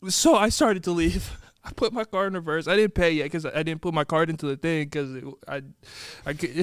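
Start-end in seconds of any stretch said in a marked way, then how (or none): none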